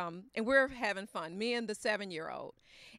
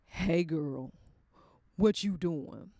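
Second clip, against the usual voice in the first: lower, smooth come-on; husband's low voice; 5 semitones lower, slower than usual. Retold or imitated. Husband calling his wife, low and smooth.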